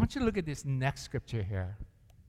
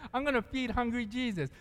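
imitating her words; 8 semitones higher than usual. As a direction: repeat her line as she said it. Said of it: "That's how she said it".

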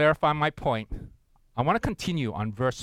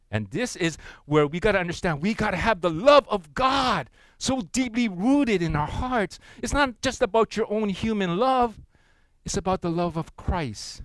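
preaching; 5 semitones higher than usual. Acted. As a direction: drive the point home from the pulpit. Preaching climax.

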